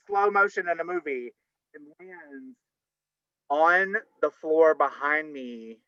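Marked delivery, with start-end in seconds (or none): none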